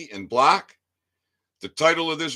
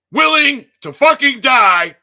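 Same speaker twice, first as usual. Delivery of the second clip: loud, higher angry burst; furious shout; 8 semitones higher, 12 dB louder than usual. Real, not acted. Vlogger challenging police, sudden furious shout.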